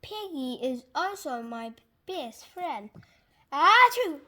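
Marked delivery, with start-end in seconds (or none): 3.5-4.2 big explosive achoo; loud sneeze. Acted. Gerald sneezing on his friend Piggy, loud achoo. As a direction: burst out in a huge sneeze.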